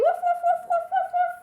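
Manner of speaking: high, loud rapid woofs; yappy pretend dog noise; puppy barks. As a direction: bark like an eager puppy. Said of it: "lots of animal sounds in this song".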